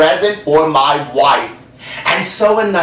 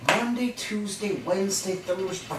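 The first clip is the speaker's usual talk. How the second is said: much softer, counting to himself; muttering days; 5 semitones higher, 14 dB quieter than usual. Acted. Cowboy working out the riddle, far softer counting. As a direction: whisper through the days of the week.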